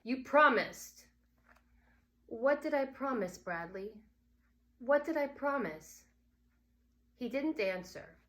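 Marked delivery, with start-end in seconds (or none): 0.0-1.1 put-on accusing child. Bradley accusing his mother. 2.3-6.0 questioning mother. Acted.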